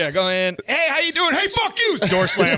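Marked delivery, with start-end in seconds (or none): 0.7-1.3 high mock greeting then snapping fuck you, much higher; lampooning a friend's blunt meetings; mocking Andrew. Teasing Andrew's meetings, high mocking voice. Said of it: "that's what it sounds like". 1.3-2.0 much higher mocking snap; mock fuck you. Teasing Andrew's meetings, high mocking snap. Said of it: "that's what it sounds like".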